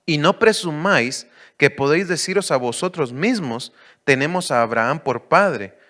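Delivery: loud preaching read of scripture; preacher proclaiming scripture. Acted. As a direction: proclaim the verse to the church.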